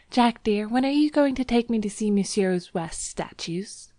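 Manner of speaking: put-on high, lilting young woman's question; bright. A narrator's reading. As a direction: speak up brightly as the girl. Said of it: "she spoke up brightly".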